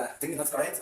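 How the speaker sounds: ordinary talk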